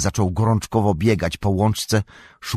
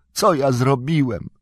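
higher, stricken cry; rozpaczliwy okrzyk; 7 semitones higher than usual. A narrator's reading. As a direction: lament what you have done. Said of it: "krzyknął". (wysoki głos pełen rozpaczy)

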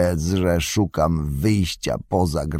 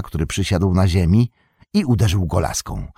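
ordinary delivery, nothing marked

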